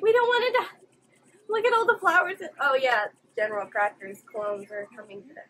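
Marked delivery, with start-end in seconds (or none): 0.0-2.4 high, loud comic wail; mock panicked soldiers. Imitating everyone not wanting to die, mock panic. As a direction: imitate frightened minions in a raised voice. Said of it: "everyone's like".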